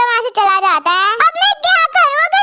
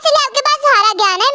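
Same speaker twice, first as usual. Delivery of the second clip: normal talking voice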